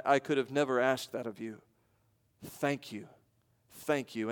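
low, soft, halting thanks; nearly speechless. Retold or imitated. Convicted man thanking the father, quiet and nearly speechless. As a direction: mildly imitate a man lost for words. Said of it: "almost without words".

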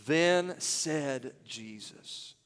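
very slow, each word drawn out; measured, emphatic verse reading. Shown as a demonstration. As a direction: read it out deliberately, word by word. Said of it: "Read them with me".

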